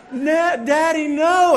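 put-on high, loud toddler shriek; toddler wailing. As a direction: protest like a panicking little kid. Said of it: "And he's going".

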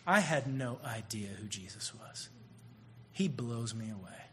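whispered, hushed and soft; hushed retelling. Retold or imitated. Preacher retelling a student's quiet confession in a whisper. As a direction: breathe the kid's confession like a secret. Kid's words retold in a hush.

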